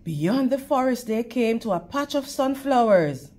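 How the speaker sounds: usual speaking voice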